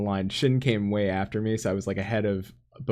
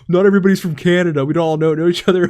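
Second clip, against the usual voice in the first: loud, fast, higher mock snap of annoyance; nasty retort; 7 semitones higher, 8 dB louder than usual. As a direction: imitate a rude stranger snapping back, louder and quicker. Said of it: "I got the nastiest, like, response".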